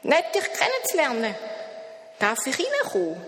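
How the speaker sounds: high, odd little creature voice; strange creature voice. A narrator's reading. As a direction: sound friendly and strange at once. Das Etwas spricht. (hohe, fremde Wesenstimme)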